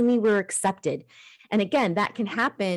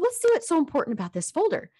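playful curiosity; 8 semitones higher, faster than usual. Retold or imitated. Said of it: "I said".

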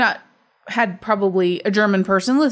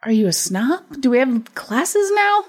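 teasing outrage, rising high; mock indignation; 5 semitones higher than usual. Co-host teasing the other about class snobbery, mock indignant. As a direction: play scandalized, climbing in pitch at the end.

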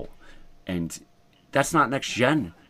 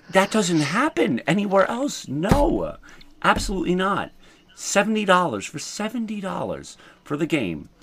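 much higher, animated outrage; outraged rant; 6 semitones higher than usual. Real, not acted. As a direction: rant in disbelief.

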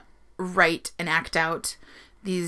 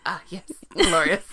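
shitty impression; 2 semitones lower than usual. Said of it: "shitty impressions that you're like".

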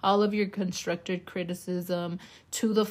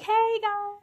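very high, sing-out hello; mock greeting; 13 semitones higher, 3 dB louder than usual. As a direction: call out to visitors in a high put-on voice. Imagines greeting guests at the gate.

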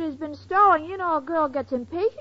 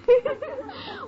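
giggle; 12 semitones higher than usual. Real, not acted. [name] laughs at her own joke.

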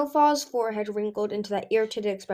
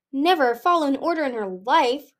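mystified; 5 semitones higher, 6 dB louder than usual. A narrator's reading. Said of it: "enchanted and mystified".

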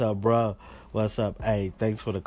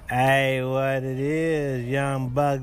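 slow, louder radio host greeting; announcer greeting; 5 dB louder, much slower than usual. Acted. Host greeting young listeners, slow louder announcer sign-on.

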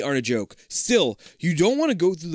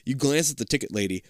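ordinary talk, nothing marked

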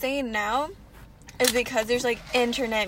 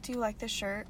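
mock normal; 3 semitones lower, 12 dB quieter than usual. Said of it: "we were just like".

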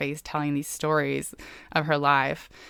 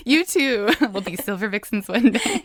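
laughing through the words; 5 semitones higher, 5 dB louder than usual. Real, not acted. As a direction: giggle while teasing. Teasing young starlets, laughing.

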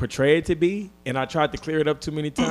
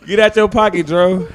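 louder, playful taunt; teasing call; 5 semitones higher, 7 dB louder than usual. Real, not acted. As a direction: call it out to needle him.

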